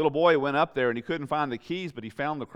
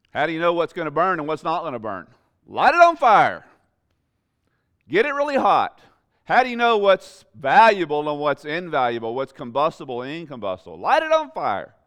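much higher and louder, almost a shout; full-force preaching; 5 semitones higher, 9 dB louder than usual. Acted. Preacher telling the congregation to light it on fire, shouting. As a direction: cry it out like fire from the pulpit.

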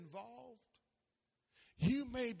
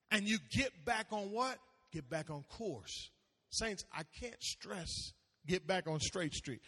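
usual speaking voice

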